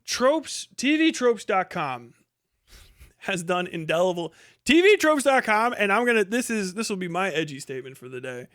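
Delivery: normal talking voice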